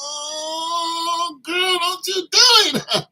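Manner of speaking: high, slow teasing voice; mock flirty reply. Man flirting back at the store lady, high and slow. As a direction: tease her in a high drawn-out voice.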